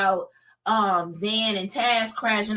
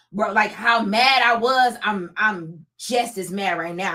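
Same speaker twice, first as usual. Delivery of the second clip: usual speaking voice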